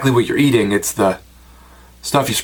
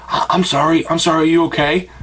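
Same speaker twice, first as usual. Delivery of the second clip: higher-pitched, lightly acted remorse; a touch of acted guilt, pitched up; mock apologetic; 5 semitones higher than usual. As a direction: imitate someone suddenly feeling bad and checking in. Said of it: "like, feeling bad".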